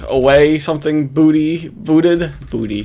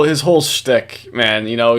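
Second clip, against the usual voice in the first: ordinary talk, nothing marked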